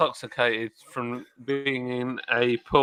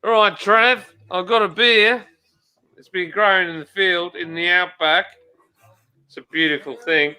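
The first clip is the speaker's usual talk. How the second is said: put-on Aussie accent, pitched up at first; amateur Australian impersonation; 2 semitones higher than usual. Shown as a demonstration. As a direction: greet a mate in mock Australian. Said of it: "I can do an Australian accent".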